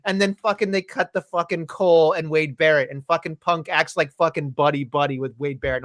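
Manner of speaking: ordinary talk, nothing marked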